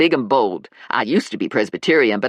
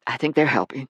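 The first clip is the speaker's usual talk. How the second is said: whisper; faster than usual. Acted. Lula quietly recovering.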